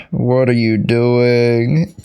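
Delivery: ordinary delivery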